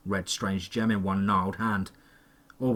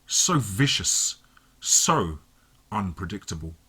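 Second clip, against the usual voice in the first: slow, sighing, fondly relishing; 4 semitones lower, slower than usual. A narrator's reading. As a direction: savour each word with a fond sigh. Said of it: "sighed fondly".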